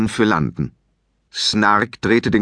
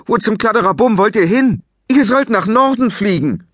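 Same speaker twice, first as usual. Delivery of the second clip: much higher and louder scolding call; higher scolding shout; exasperated shout; 10 semitones higher, 4 dB louder than usual. Acted. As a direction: yell across to them. Shouting at wrong-way dragons.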